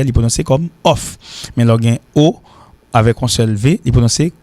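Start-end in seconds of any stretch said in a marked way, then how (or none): 0.8-1.2 off ending on a crisp f; voiceless ending demo. Shown as a demonstration. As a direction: say off with an airy final f. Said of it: "il y a deux F après".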